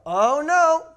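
high, loud drawn-out "Oh no"; mock commiseration. Dad joins child facing broken window, mock dismay. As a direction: lament the mishap as a teammate, hammed-up. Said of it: "Here's what it looked like".

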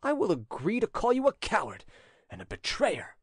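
high-pitched accusation, dropping at the end; indignant retort. A narrator's reading. Gabriel answers his sister's insolent suitor, indignant. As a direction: throw the insult back at him. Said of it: "whose face had begun to glow".

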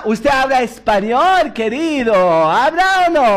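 put-on slow Spanish-accented Pharaoh. Pharaoh testing Joseph in Spanish, slowly. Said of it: "יודע מה".

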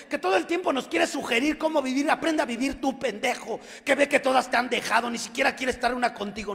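mock a mother's tirade; angry mother. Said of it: "se fue bien recio".

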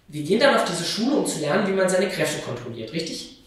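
höher, lauter und schnell; eifrige Nachfrage. Acted. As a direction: ganz eifrig nachhaken. Tochter fragt aufgeregt nach. (Tochter fragt die Mutter eifrig, schneller und lauter)

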